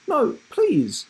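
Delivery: high desperate plea; beg, dismayed; pleading boy. Acted. Boy begs his teacher, high plea.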